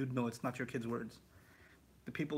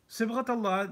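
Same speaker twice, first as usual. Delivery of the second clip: higher and louder, announcing "Sibghat Allah"; emphatic announcement; 9 semitones higher, 7 dB louder than usual. Shown as a demonstration. Teacher announcing the verse to the class. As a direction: call out "Sibghat Allah" like a heading.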